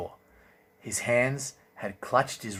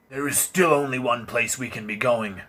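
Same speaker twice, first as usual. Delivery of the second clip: calling over the hooves, louder; called out; 4 semitones higher, 9 dB louder than usual. Acted. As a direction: raise the voice to carry through fog. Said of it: "he called".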